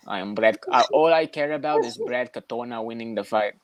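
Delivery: slow mock of a mixed Canadian-Irish accent; Brad Katona's accent. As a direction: drag it out in a jumbled Canadian, American and Irish accent. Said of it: "and he always be like this".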